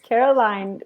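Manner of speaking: ordinary delivery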